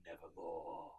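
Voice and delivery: low, slow, eerie; raven voice. A narrator's reading. Narrator speaks the raven's name, low and eerie. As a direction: draw it out ominously.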